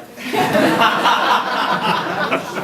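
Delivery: loud bursting laugh; big laugh. Real, not acted. Comedian laughing at his own intro.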